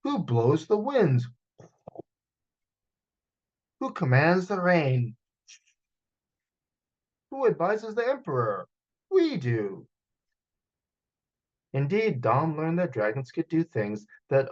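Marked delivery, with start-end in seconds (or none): 0.0-9.9 puffed up pride. Acted. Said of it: "Papa puffed".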